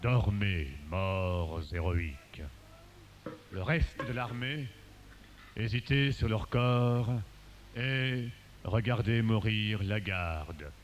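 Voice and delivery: low, hushed verse declamation; solemn elegiac recital. Acted. Reciter mourning the dying guard, hushed and solemn. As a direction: sink low and speak it gravely.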